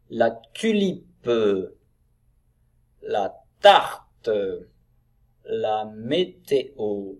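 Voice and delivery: slow model reading, syllables drawn out; word-by-word reading. Shown as a demonstration. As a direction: sound each word out for beginning readers. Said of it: "Allez, on enchaîne".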